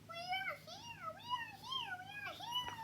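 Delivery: tiny squeaky voices, very high; tiny Whos crying out. Acted. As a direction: squeak like a crowd of tiny people. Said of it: "his people cried loudly".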